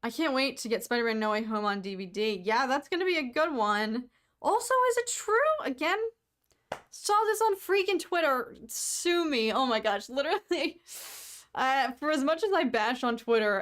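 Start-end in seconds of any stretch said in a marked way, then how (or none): 4.4-8.6 high, eager gossip; excited. Real, not acted. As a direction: blurt it out excitedly. Sudden excited question.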